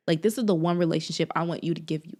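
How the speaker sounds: ordinary delivery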